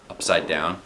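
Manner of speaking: plain reading voice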